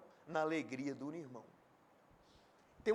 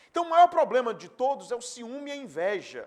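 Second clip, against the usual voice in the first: preaching loud; 5 semitones higher, 13 dB louder than usual. Acted.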